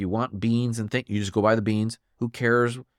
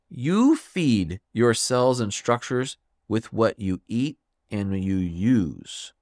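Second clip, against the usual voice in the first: ordinary talk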